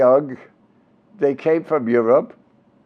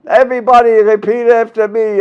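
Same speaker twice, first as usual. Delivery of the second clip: put-on loud comic Yiddish accent, calling on a class; Willie Howard; 7 semitones higher, 6 dB louder than usual. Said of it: "parts of his act with that accent".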